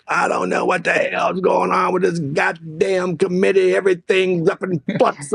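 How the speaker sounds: high-pitched exasperated cursing; retold red-faced rant; cussing in anger. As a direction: imitate a worked-up boss cursing.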